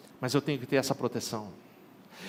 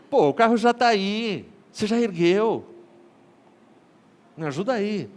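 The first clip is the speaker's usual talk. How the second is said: pleading haggle; 7 semitones higher, 5 dB louder than usual. Retold or imitated. Haggling with the tire shop manager.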